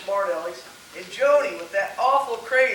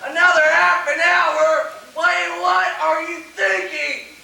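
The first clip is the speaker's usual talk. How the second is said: high, loud, slow incredulous complaint; angry outburst; 7 semitones higher, 6 dB louder, slower than usual. Acted. Husband told dinner will be even later, angry outburst. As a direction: explode over the delay.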